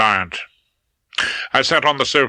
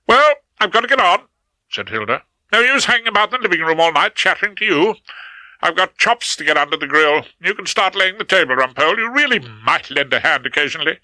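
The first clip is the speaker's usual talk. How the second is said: brisk, bossy wife; 7 semitones higher than usual. A narrator's reading. Wife tells Rumpole to lay the table, brisk and bossy. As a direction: snap back into the bossy housewife, getting on with chores. Hilda back to brisk bossiness.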